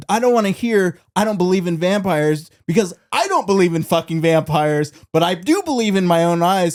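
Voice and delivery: ranting like a fed-up gunman; Clooney rant. Retold or imitated. Fan imitates George Clooney insisting vampires are real, loud and high. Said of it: "George Clooney's like".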